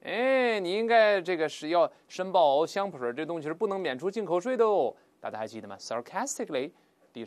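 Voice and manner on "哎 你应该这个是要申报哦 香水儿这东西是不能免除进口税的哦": taunting query with big pitch swings; higher, needling mock-official tone; sarcastic. Customs officer taunting a traveller about perfume, sarcastic. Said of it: "他讥讽地说".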